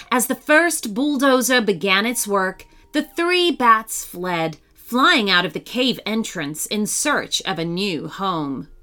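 ordinary delivery, nothing marked

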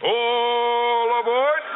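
high, slow drawn-out call; conductor's call. Acted. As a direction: stretch it out like a train conductor. Conductor call to close show.

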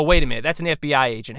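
usual speaking voice

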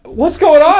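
startled awake, asking in alarm; half-asleep alarm. Retold or imitated. Roommate woken by sleep talking, high alarmed question. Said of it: "I was like".

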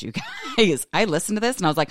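normal talking voice

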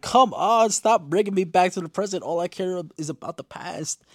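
loud, high exasperated plea; high, loud whine easing off at the end. Retold or imitated. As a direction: burst out in playful frustration. Acts out exasperated viewer reaction.

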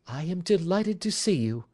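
high, gracious woman's voice; wife warmly greeting Watson; warm welcome. Acted. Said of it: "pressing my hand warmly".

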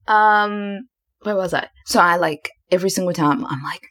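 normal talking voice

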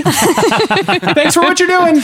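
high, slow mock-grateful voice; gushing passerby. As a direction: drag it out like a sappy admirer. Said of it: "being like".

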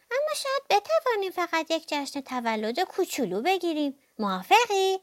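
high, hopeful little mouse voice; pleading mouse. A narrator's reading. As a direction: coax the bear in a high-pitched voice. Mouse pleads for tiny party. (خواهش موش با صدای زیر)

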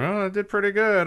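humble slugger playing it down; aw-shucks ballplayer. Ballplayer voiced in retold interview.

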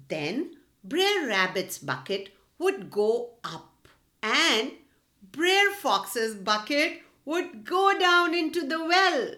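ordinary delivery, nothing marked

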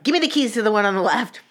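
quick, high demand; retold no-nonsense urgency; impatient order. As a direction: cut straight to it, rushed and forceful.

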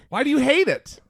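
ordinary talk, nothing marked

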